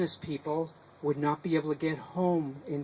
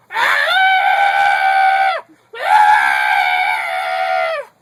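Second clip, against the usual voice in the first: scream; 13 semitones lower, 12 dB louder than usual. Acted. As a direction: howl it out twice at full voice. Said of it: "heard a scream from the stranger who went".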